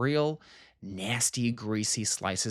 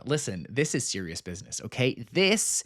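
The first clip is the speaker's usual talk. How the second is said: ordinary delivery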